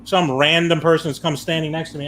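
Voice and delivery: normal talking voice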